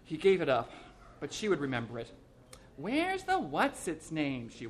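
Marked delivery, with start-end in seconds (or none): 2.8-4.5 slow, higher, drawn-out wife voice; a hint of the demanding wife; nagging wife. A narrator's reading. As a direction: voice his wife asking slowly.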